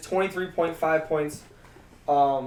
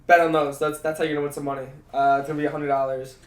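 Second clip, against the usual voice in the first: usual speaking voice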